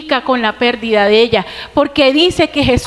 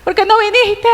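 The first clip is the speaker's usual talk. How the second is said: tearful plea; 8 semitones higher than usual. Retold or imitated. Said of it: "y me imagino llorando".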